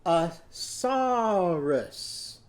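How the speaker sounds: "A saurus" stretched out very slow; slowly, syllable by syllable. Shown as a demonstration. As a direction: draw out "saurus" slowly for the children. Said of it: "go down to your knees".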